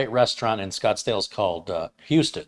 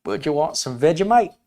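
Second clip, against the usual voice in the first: higher, louder put-on Aussie; bad Australian accent; 7 semitones higher, 6 dB louder than usual. Host trying to be relatable to Australians, bad Aussie accent. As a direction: have a go at a clumsy Australian accent. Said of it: "I'm not good at it".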